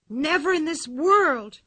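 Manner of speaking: put-on frightened girl refusing outright; firm refusal. A narrator's reading. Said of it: "said Anika".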